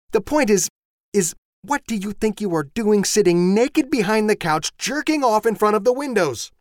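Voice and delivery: high, loud, exasperated tirade; wife shouting, high and sharp; furious wife. Acted. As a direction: let the anger boil over at him. Voices the wife Susan.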